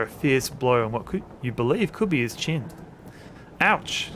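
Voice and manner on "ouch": mild high yelp of pain; crying out. Acted. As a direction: yelp as if just punched. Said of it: "William Mayfield cries out".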